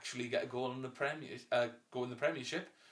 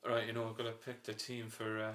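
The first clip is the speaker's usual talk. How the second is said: bad Brendan Rodgers; 3 semitones lower, 7 dB quieter than usual. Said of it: "my bad Brendan Rodgers".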